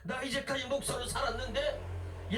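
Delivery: ordinary delivery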